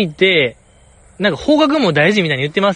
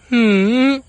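imitated high little hum; small interjection; 7 semitones higher, slower than usual. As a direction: hum it high, as a tiny acted aside. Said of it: "ちっちゃく。". (高めの小さな相づちの真似)

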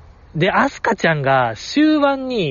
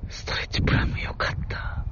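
whispered aside, much softer; 9 dB quieter than usual. Real, not acted. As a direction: breathe it out as a private aside. Aside after naming the horse. (ひそひそ声で)

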